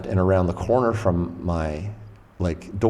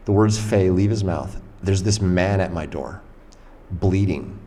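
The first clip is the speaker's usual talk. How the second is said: ordinary delivery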